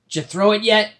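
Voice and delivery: high, loud pirate; impatient pirate. Acted.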